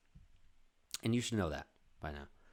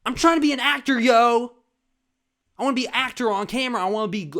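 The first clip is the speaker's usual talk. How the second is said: high, loud fast rant; 9 semitones higher, 12 dB louder than usual. Real, not acted. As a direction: rant it out at full volume. Ranting loudly about being actor.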